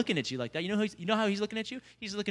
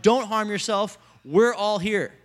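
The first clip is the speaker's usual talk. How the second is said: mild raised-voice call; shouted warning; 4 semitones higher, 7 dB louder, slower than usual. Acted. Preacher reads Paul calling to the jailer, mild shout. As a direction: call it out, higher, as a reader would. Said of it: "But Paul shouted".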